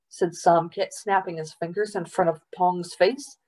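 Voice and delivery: usual speaking voice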